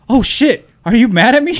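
high, loud startled question; mock alarm. New dad retelling his first reaction, mock alarm. Retold panicked reaction to surprise.

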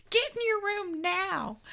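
high, slow mock-scolding yell. Joining in shouting at the couple on TV, mock yelling. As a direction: draw out a playful high-pitched order.

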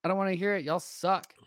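playful high-pitched sulk; mock whining refusal. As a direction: whine it like you refuse to listen. Acted refusal to robocall in story.

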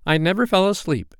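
put-on high, emphatic boyish claim; self-assured boy. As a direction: insist on it, fully believing yourself. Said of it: "quite believing himself".

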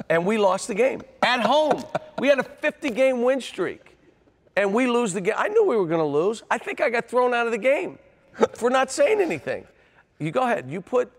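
very high, loud, exasperated outburst; incredulous rant. Real, not acted. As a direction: shout the grievance in comic disbelief. Incredulous rant about lost game.

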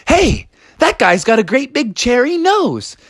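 put-on high-pitched child calling out; jeering kid. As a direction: point him out in a high kid voice. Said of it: "he heard some kids saying".

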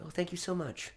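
hushed, lifted thank-you; softened thanks. Acted. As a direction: soften right down for quiet-voiced company. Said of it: "I try to soften to them".

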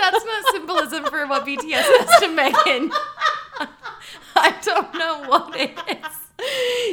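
talking through high, loud laughter; cracking up. Real, not acted. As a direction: joke while losing it laughing. Cracks up at the confetti story.

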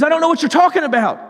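fast, high-pitched mock protest; acted, rushed brush-off; defensive denial. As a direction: imitate someone hurriedly denying everything. Peter denying when accused.

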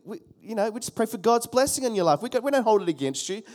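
usual speaking voice

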